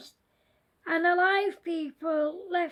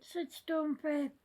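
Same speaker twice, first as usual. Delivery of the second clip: usual speaking voice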